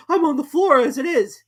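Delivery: high, loud protest; high, loud Hatter; pitiful Hatter. A narrator's reading. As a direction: plead from the floor. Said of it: "said the Hatter".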